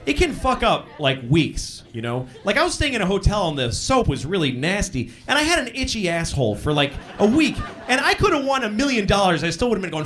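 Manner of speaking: normal talking voice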